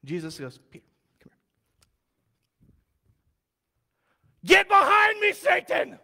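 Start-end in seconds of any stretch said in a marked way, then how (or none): 0.7-2.7 breathy whispered summons, slow. Retold or imitated. Jesus whispers Peter over, slow. 4.4-6.0 loud, high, sudden shout; furious rebuke. Retold or imitated. Jesus rebukes Peter, loud and high.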